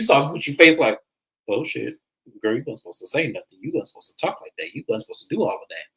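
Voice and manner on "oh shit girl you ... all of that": soft, low, rapid-fire reproach; hushed scolding. Retold or imitated. Imitating a friend whose secret was spilled, hushed quick scolding. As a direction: scold under your breath, fast.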